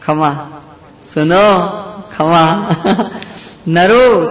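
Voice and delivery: put-on the child Lord calling his servant; calling out from above. A narrator's reading. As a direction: call Naro down from upstairs with a laughing drawn-out final name. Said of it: "બૂમ પાડી".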